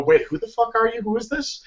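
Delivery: high, baffled promoter on the phone; suspicious caller demanding who this is; confused promoter. Retold or imitated. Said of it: "promoters always go".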